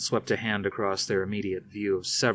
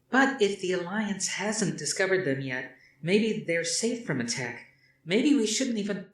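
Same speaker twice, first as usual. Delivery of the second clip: put-on higher, pressing young woman; young woman's voice; 11 semitones higher than usual. A narrator's reading. Athena objecting to her father's plan, higher. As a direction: argue against the plan.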